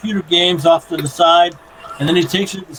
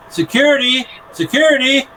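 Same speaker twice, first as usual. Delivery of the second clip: higher robot alarm call; robot calling security; 7 semitones higher than usual. Acted. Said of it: "and he says".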